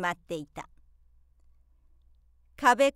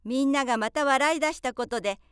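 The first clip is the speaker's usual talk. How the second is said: ordinary delivery, nothing marked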